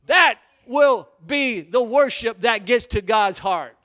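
loud, slow pulpit pronouncement; weighty full-force declaration; emphatic preaching. Real, not acted. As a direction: drive the point home slowly and loudly. Preacher drives the point home.

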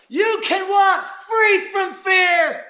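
high, slow shouted proclamation; full-force preaching. Real, not acted. Preacher declares freedom to the congregation, slow full-force shout. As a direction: stretch each word into a triumphant shout.

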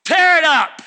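loud, high, daring them to burn; fiery challenge. Retold or imitated. Jesus goading the angry crowd, shouted. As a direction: fan the flames at them. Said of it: "He said".

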